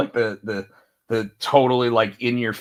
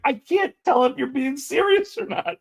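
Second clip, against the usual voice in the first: amused disbelief; 12 semitones higher than usual. Retold or imitated. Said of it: "just starts laughing".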